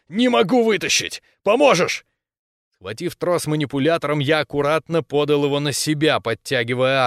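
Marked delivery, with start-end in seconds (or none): 0.1-2.0 high, urgent call for help; straining growl. A narrator's reading. Ramirez pulling at the stuck corporal, straining shout. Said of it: "прорычал Рамирес".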